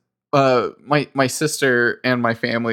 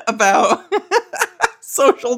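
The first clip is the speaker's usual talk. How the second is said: high burst of laughter mid-sentence; laughing at himself; 8 semitones higher, slower than usual. Real, not acted. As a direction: laugh through the word. Laughing at own joke.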